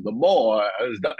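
weighty mock-authority; Cosby impression. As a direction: intone it like a stuck refrain. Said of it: "Cosby's got his hand ... just going".

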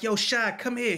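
high, loud call across the block. Retold or imitated. Buddy calling him over from his cell. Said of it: "my man is like".

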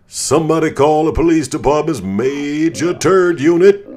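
high, loud joking call for the police; mock alarm shout. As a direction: shout it like a silly emergency alert. Mock announcement after a kill.

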